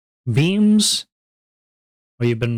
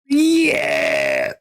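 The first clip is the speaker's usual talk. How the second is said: high, loud whoop; excited yell; 11 semitones higher, 4 dB louder than usual. Real, not acted. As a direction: let out a happy whoop. Cheering about getting food.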